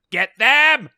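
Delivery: high, loud villain shout. Acted. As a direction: yell it at the henchmen.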